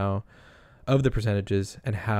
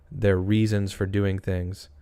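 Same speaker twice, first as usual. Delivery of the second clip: usual speaking voice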